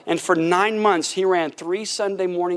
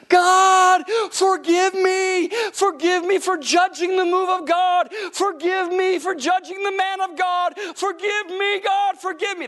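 screaming repentance; 10 semitones higher, slower than usual. Retold or imitated. Pastor's wife cries out to God, screaming repentance. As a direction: wail to God at the top of your lungs, higher. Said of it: "starts screaming at the top of her lungs".

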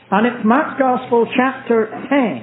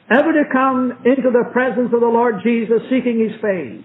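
ordinary talk

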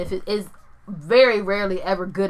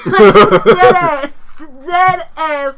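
giddy fan excitement; 7 semitones higher, 9 dB louder than usual. Real, not acted. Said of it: "I might just pass out".

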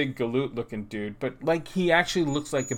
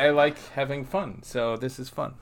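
normal talking voice

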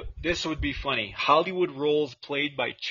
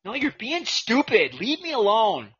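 put-on childish squabble; 6 semitones higher, 4 dB louder than usual. Acting a kid's quarrel.

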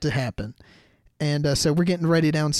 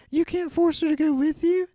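much higher, shrieking mother's protest; alarmed mom; 13 semitones higher, 4 dB louder than usual. Retold or imitated. Narrator mimicking the mother hearing about skydiving, high alarmed. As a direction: squeal it like a horrified mom.

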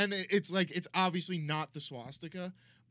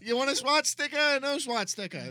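loud, raised New York caricature; New York accent; 8 semitones higher, 6 dB louder than usual. Acted. Swat officer handing out stickers, New York accent. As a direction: push the offer in a mock New York accent. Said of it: "like, a New York accent".

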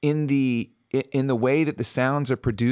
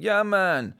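high-pitched put-on Jamaican accent; hammed-up Jamaican catchphrases, pitched up; 7 semitones higher than usual. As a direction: imitate people doing a stock Jamaican accent. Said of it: "do the Jamaican accent".

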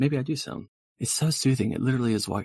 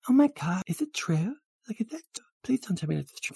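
put-on high, girly pleading disbelief; high-pitched anxious girlfriend; gushing valley girl, worried; 13 semitones higher than usual.

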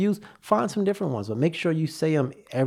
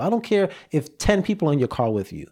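normal talking voice

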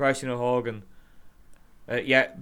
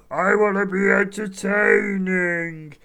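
loud, high, drawn-out mock shout; hammed-up impression of an entertainer's gimmick; Big Show; 6 semitones higher, 6 dB louder, slower than usual. Host imitating a wrestler's gimmick, hammed-up shout. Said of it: "doing a gimmick where he was just".

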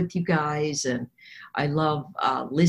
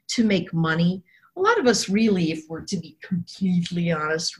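ordinary talk, nothing marked

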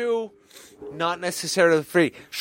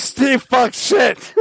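laughing; 7 semitones higher, 3 dB louder, much slower than usual. Real, not acted. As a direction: blurt it out through laughter. Drunk chanting, laughing.

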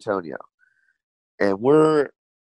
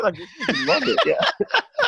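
words squeezed out through real laughter, high; laughing; slower than usual. Real, not acted. Comic laughs while telling the crowd story, laughing through words. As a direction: laugh as you talk.

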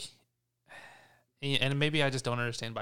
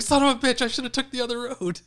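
high-pitched imitation of a frustrated driver; hammed-up angry whine, starting loud; mock exasperated cursing; 11 semitones higher, 6 dB louder, faster than usual. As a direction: curse the detour, voice shooting way up. Imitating friend's anger at detour.

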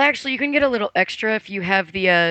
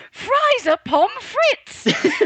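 much higher, very slow, laughing through it; laughing quote; much slower than usual. Retold or imitated. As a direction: squeal it out slowly while laughing. Quoting him, laughing.

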